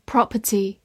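British "Property", rounded o and no r in the middle; British accent. Shown as a demonstration. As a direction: say "Property" the British way, PROP-uh-tee. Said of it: "美式发音".

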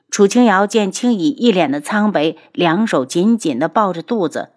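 ordinary delivery, nothing marked

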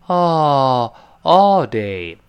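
very slow, rounded British "all day"; British accent. Shown as a demonstration. Teacher mimicking British pronunciation of all day. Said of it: "这英国人就哦".

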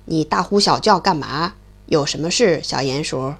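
put-on low, gruff old stork. The stork grumbles low at the noisy mole. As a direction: grumble low like a big bird.

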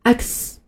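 "X" as eks in one smooth go; joined letter. Shown as a demonstration. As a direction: model "X" from its parts. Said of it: "我们把以上几个音连起来念".

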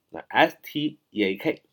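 ordinary talk

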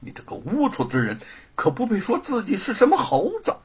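haughty, angry denunciation; scornful tirade rising to a dismissal; indignant. Acted. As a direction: berate him with growing indignation. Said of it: "怒视着狱卒".

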